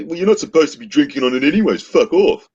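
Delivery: high, fast put-on dismissal; mock curt official. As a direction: brush him off in a quick snide voice. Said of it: "they'd say".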